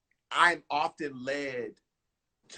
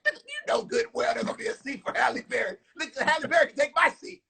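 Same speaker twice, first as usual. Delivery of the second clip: high, fast excited rush; hyped inner voice; 12 semitones higher, faster than usual. Retold or imitated. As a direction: blurt it out, giddy and fast. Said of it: "inside, I'm like".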